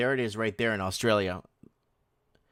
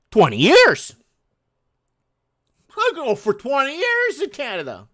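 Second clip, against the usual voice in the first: mock rant; 13 semitones higher, 10 dB louder than usual. Mock ranting about Canada.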